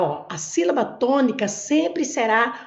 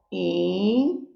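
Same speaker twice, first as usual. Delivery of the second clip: "in-" held long and lower, rising at the end; isolated first syllable; 4 semitones lower than usual. Shown as a demonstration. Teacher starting to separate a word's syllables, "in-" drawn out. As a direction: stretch "in-" out as a lone syllable. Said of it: "vamos separar a sílaba aqui".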